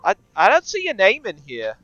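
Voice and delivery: very high, loud receptionist; loud, high-voiced front desk greeting; receptionist character voice. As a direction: pipe up loudly, high-pitched. GM plays receptionist Sheila.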